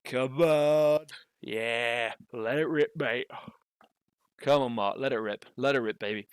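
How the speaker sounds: loud, drawn-out cheer easing into urging; hyped cheering. Real, not acted. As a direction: egg the player on loudly. Commentator urging the player on.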